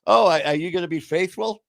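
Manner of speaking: mock exchange. Mock dialogue of uncaring self.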